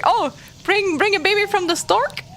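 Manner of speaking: high-pitched naive character voice; innocent Michael. Retold or imitated. Retelling an innocent character's question, high and naive. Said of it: "Michael was like".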